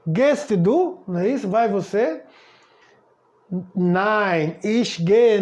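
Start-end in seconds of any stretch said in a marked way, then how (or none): 0.0-1.0 "Gehst du" higher, with a rise on du; question tune. Shown as a demonstration. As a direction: ask "Gehst du" with lifted ending. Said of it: "Pra interrogar".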